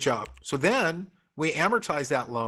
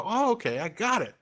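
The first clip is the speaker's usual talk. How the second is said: mock relieved student; 7 semitones higher than usual. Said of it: "then you go".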